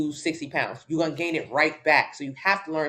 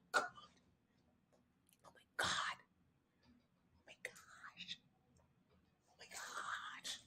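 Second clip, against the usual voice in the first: breathless, slow, barely voiced whisper; stunned whisper; 24 dB quieter, much slower than usual. Real, not acted. Reacting to a comment, slow stunned whisper. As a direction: let the shock steal your voice.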